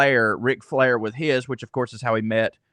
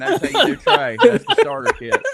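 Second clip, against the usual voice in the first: laughing; 7 dB louder than usual. Real, not acted. Laughs after finishing impression.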